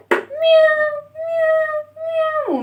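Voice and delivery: high-pitched, drawn-out meows; long, very high kitty meows; cat meow imitation. Shown as a demonstration. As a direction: mew like a cat for the children. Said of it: "والقطة بتعمل ازاي يا ولاد؟".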